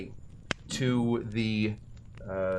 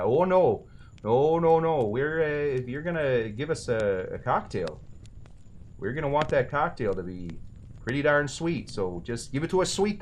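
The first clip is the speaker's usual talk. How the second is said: Wisconsinite quote with a Minnesotan lilt; Minnesotan accent. Acted. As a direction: put on a touch of Minnesotan accent. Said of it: "Skewing a touch Minnesotan there".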